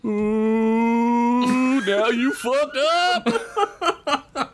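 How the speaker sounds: high, very slow drawn-out troll taunt; yelling troll. Acted. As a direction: drag it out like a gleeful troll. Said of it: "A troll in the background yelled".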